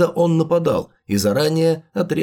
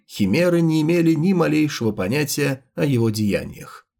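usual speaking voice

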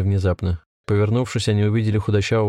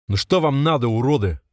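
higher-pitched, contained fury; furious shout; 4 semitones higher than usual. A narrator's reading. Volodya yells at captors, mildly voiced rage. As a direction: demand it angrily, without full volume. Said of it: "в ярости закричал Володя".

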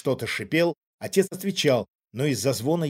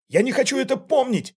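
high-pitched, louder outburst; anguished cry; 12 semitones higher, 5 dB louder than usual. A narrator's reading. Father refuses to remember the mine, crying out. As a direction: cry out against the memory. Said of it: "вскричал отец".

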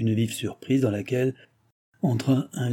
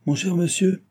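high, eager call; lightly acted cry, pitched well up; 9 semitones higher, 3 dB louder than usual. A narrator's reading. Postmaster to the impatient gentleman, calling out. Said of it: "cria le maître de poste".

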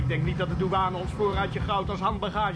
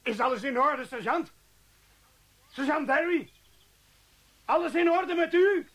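worried calling out; 10 semitones higher, 4 dB louder than usual. Acted. Calling to sergeant after explosion.

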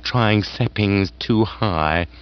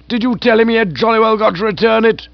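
higher, louder, gushing aged aunt; hearty aunt voice; 12 semitones higher, 4 dB louder, faster than usual. Acted. Aunt gushing to Bertie about lunch. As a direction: raise the pitch and relish the food.

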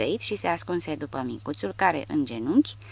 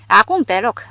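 eager bark; 5 semitones higher, 9 dB louder than usual. Acted. Haide eager to shoot.

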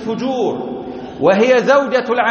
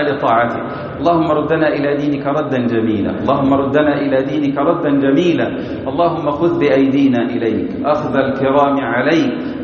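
low, even-toned supplication; real prayer in a level, reciting cadence; closing prayer; 4 semitones lower than usual. Real, not acted. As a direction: lead the congregation in a steady, lowered-pitch dua.

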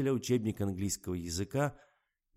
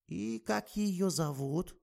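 put-on slow, high drowsy old woman; sleepy; 10 semitones higher, much slower than usual. A narrator's reading. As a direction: ask while nodding off. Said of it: "сонно поинтересовалась старуха".

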